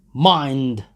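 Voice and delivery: loud bark; warning shout. A narrator's reading. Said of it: "he shouted".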